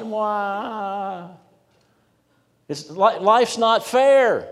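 high, drawn-out whiny wail; mock whining. Preacher mocking Israel's complaint to God, whiny and high. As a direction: whine like a sulking child. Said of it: "after that whining session".

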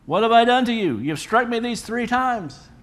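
high hurt donkey voice asking why it was struck; donkey's plea. Acted. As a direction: squeak it out as the wronged donkey, slowing at the end. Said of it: "And she said to Balaam".

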